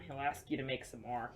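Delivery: ordinary delivery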